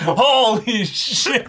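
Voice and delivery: slow, high, loud stunned holy shit; mock awe. Fan acting floored by the scene, slow high stunned exclamation. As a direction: drag it out, floored.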